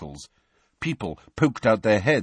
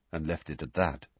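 ordinary delivery, nothing marked

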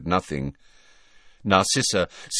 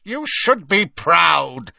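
higher, louder, slow and merciless; ruthless Bellatrix; 9 semitones higher, 6 dB louder, slower than usual. A narrator's reading. Bellatrix mocking her sister's grief, ruthless. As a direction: sneer it coldly. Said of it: "said Bellatrix ruthlessly".

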